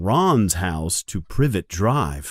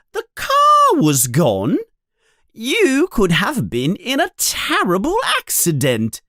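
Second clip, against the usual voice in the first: screaming mother; 10 semitones higher, 3 dB louder than usual. Acted. Furious mother threatening her sons with their father, loud. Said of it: "she screams".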